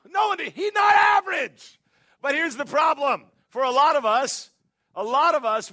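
fervent sermon shout; preaching climax. Real, not acted. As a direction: shout it from the pulpit.

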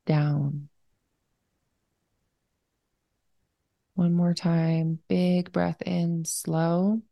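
unhurried, even-toned breathing cues; guided meditation. Real, not acted. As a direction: guide them into slowing down, leaving room to breathe.

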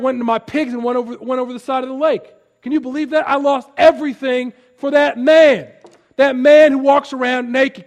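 loud, high, fast outraged complaint; upset herdsman. Retold or imitated. Herdsman telling the town he lost his pigs, indignant. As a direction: rant about losing everything. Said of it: "do you see the different emphasis".

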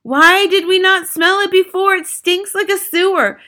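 high, loud disgusted cries; shouting. A narrator's reading. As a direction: cry out in revolted alarm, long wailed poohs. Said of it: "they shouted".

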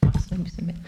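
whispering. Real, not acted. Quiet aside about the tea.

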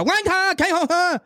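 very high sing-song fake Chinese; mock Chinese gibberish.

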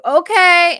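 high, loud chirpy okay; mock eager yes. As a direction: blurt it out, all in. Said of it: "And I was like".

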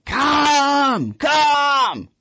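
high, drawn-out mock shout; imitating a wife yelling for the dogs; imitated yelling. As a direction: holler it high like calling dogs in. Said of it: "she's yelling".

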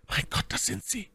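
high, terrified whisper; frightened Milady. A narrator's reading. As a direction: gasp it barely audibly, in dread. Milady hears the patrol arrive. (ängstliches, hohes Flüstern)

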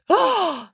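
sharp shocked intake of breath; collective gasp. A narrator's reading. Crowd hears the constable accused, shocked gasp. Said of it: "collective intake of breath".

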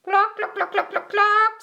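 high, rapid clucks; chicken clucking. A narrator's reading. Chicken answers Duck's hello, clucking high. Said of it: "said Chicken".

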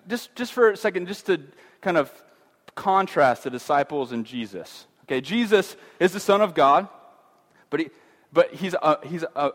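ordinary delivery

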